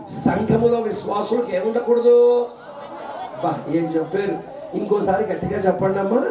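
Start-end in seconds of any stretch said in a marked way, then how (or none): none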